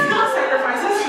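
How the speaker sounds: high, loud laugh through the words. Real, not acted. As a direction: laugh at your own cheeky confession. Laughing at her newspaper theft.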